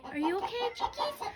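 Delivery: sweet pet voice, very high; talking to chickens. Real, not acted. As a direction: coo to frightened chicks.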